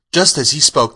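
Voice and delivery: ordinary delivery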